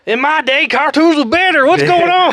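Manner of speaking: loud, high, hammed-up geezer shout; mock old-timer rant. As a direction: holler like an outraged old-timer. Mock old-timer ranting about cartoons.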